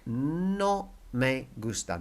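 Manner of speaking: No me gusta drawn out slowly for learners; slow teacher demo; slow model. Shown as a demonstration. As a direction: lay out No me gusta word by word. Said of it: "Here it is, look".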